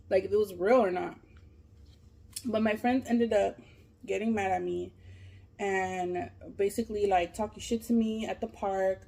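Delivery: ordinary talk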